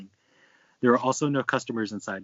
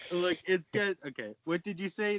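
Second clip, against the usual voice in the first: mild mock yell; 6 semitones higher than usual. A narrator's reading. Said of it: "The cashier yelled".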